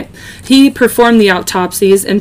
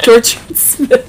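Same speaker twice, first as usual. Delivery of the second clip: doctor voice; 3 semitones higher than usual. Pretend. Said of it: "That's such a doctor name".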